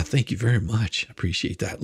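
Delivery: ordinary delivery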